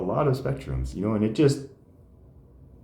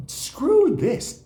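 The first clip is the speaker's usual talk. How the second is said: high, loud mock defiance; mock defiant; 5 dB louder than usual. Comedian quitting institutional help, in a loud, high mock outburst. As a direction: blurt it out sky-high and fed up.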